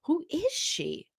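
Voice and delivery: drawn-out shocked question; mock disbelief. Host acting stunned at Morgan's new persona. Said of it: "I'm like".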